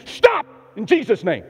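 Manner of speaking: loud, slow command at a dog; commanding stop. Retold or imitated. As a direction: stop the charging dog with authority. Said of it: "I've done this before".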